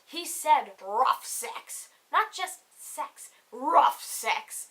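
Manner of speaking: usual speaking voice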